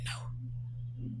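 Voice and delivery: breathless, voiceless no; soft, voiceless refusal; whispered no. Retold or imitated. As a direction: barely breathe the word no.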